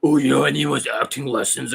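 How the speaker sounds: put-on sceptic's voice; mock dismissal. Coach imitating a critic dismissing acting lessons, in a put-on voice. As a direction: mimic someone brushing it off. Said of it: "they can dismiss that".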